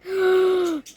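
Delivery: a quick, high, loud intake of breath; startled high gasp; sharp gasp. Retold or imitated. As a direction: gasp as if you just saw it.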